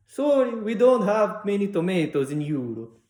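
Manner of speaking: put-on high, loud, slow accented quote; Greek sandwich man. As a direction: imitate an apologetic Greek shopkeeper, drawn out.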